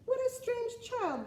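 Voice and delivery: high mocking villager voice; sneering villagers. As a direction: scoff like gossiping villagers. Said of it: "they would say".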